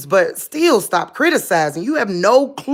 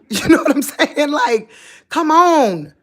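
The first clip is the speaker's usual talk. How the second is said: laughing, louder and higher; laughing exasperation; 7 semitones higher, 4 dB louder than usual. Real, not acted. Laughing at critics who judge, disbelieving.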